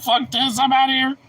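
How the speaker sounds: high voice through laughter; laughing dog voice. Acted. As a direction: voice the dog while cracking up. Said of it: "He's like".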